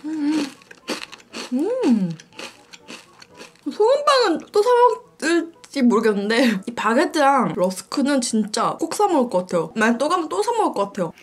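speaking between bites; chewing while talking. Real, not acted. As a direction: mouth partly full. Seen 4: holds rusk, bites repeatedly, talks between bites with lips pursed.

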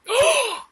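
a shrill, hammed-up gasp of shock; high mock gasp. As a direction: gasp like you're scandalized.

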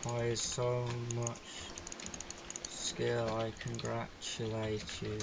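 quiet, slow, low sarcastic praise; deadpan congratulations. Real, not acted. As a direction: drag it out softly, seething underneath. Slow mock praise after death.

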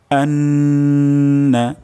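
আন্না with a long held nasal ন, drawn out and sung; nasal ghunnah. Shown as a demonstration. Teacher shows ghunnah to students, long held nasal. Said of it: "এখানে গুণনা হবে".

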